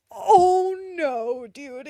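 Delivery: dismayed cry. Real, not acted. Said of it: "what I just remembered".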